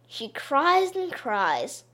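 ordinary delivery, nothing marked

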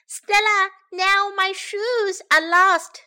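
high, loud, drawn-out wail; child calling out. A narrator's reading. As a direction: slowly wail for your big sister. Child calls sister over lost shoes.

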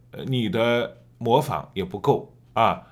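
normal talking voice

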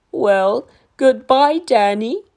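lightly voiced gentle giant saying goodbye; fond dinosaur farewell. A narrator's reading. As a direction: say goodbye kindly. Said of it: "said the dinosaur".

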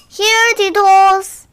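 high, drawn-out loud call. Acted. Calling back the runaway tortoise, long high call.

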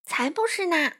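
high, quick contradiction; retort. A narrator's reading. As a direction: cut that idea down. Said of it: "花袜子反驳道".

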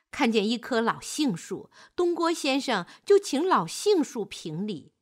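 ordinary delivery

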